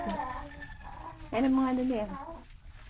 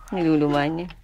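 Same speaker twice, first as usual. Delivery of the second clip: ordinary talk, nothing marked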